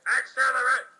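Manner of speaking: loud, high call; loud high duel shout; battle cry. Acted.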